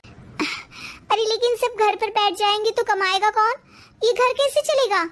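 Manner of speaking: high, worried exclamation; alarmed protest. Acted. Wife alarmed that nobody will earn, worried high protest. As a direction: raise the voice in alarm at the idea.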